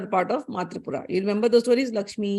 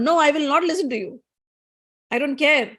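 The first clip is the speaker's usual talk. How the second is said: higher-pitched defiant refusal; stubborn kid; 5 semitones higher than usual. Acted. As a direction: act a child who won't listen. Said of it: "this kid is like stubborn".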